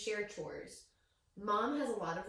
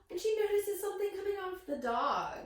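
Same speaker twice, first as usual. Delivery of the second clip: gasping surprise; 12 semitones higher, 5 dB louder than usual. Real, not acted. Reader gasping at the picture for the listening children. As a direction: gasp then higher, louder reveal. Gasp, dramatic reveal.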